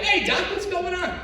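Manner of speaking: high, eager hello; answering the doctor's call, high and eager; excited greeting. Retold or imitated. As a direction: greet the doctor like an old friend. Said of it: "And I got excited".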